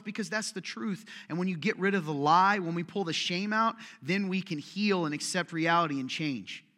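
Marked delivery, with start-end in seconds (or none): none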